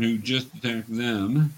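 ordinary talk

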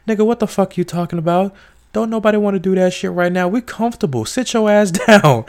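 high, fast sassy scolding; scolding wife. Retold or imitated. Wife tells husband to sit down, high sassy scold.

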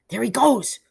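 high, loud call; excited cry. A narrator's reading. Peter seeing the boy run off, crying out. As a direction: cry out as he gets away. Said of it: "cried Peter".

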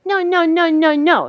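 put-on high, bright toddler no's; toddler saying no. Granddaughter refusing, high playful toddler voice. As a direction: squeak out a toddler's no. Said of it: "And she goes".

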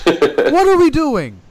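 high, slow, loud what are we doing; exasperated cry. Real, not acted. Fan ranting at pointless booking, high and loud. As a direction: plead with the bookers in disbelief.

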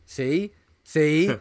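high, loud, hammering each word; over-obvious nudge to the audience; heavy-handed mock hint. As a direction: drive the point home with mock obviousness. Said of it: "hitting you over the head with a big hammer".

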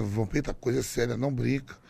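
lightly voiced serious old translator; old man of eighty. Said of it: "um velho de oitenta". (velho de oitenta avisando sério, leve)

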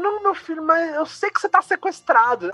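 high, insistent mother voice; imitating insistent mom. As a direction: pitch up as the worried mom. Mãe insiste no sequestro. (imitação de mãe aflita, voz bem mais aguda)

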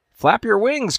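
high, urgent call to a falling chick; urgent cry. A narrator's reading. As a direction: cry out to him, pleading. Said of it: "cried Missus Bird".

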